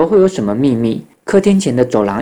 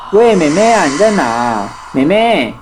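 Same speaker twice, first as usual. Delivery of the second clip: high, drawn-out searching call; acted anxious holler, not at full volume; 5 semitones higher than usual. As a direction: call through a house for a missing child. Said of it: "大家一边叫着弟妹".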